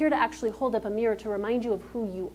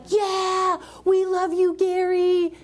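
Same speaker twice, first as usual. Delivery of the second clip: higher, cheering fan voice; adoring crowd; 9 semitones higher, 4 dB louder, much slower than usual. Retold or imitated. Crowd cheering their idol, mock adoring. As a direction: gush like a fan in the front row. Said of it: "And everyone's like".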